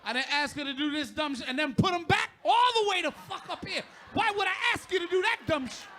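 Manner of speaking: loud, high, laughing rant; loud laughing complaint about wife's eyebrows. Real, not acted. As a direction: shout it through laughter.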